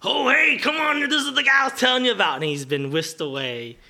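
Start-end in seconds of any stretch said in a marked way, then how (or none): 0.0-2.4 much higher and louder, fast; excited shout. Acted. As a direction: call out eagerly across the room.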